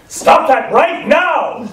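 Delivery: command voice. Retold or imitated. Woman in the car park halting two fighting men, commanding shout. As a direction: bark it out like you own the car park. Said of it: "in a command voice, authority, and danger".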